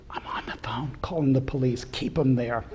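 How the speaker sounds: urgent whisper, voice almost gone; whispered warning. Retold or imitated. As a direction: whisper it so the robber can't hear. Manager phoning police during robbery.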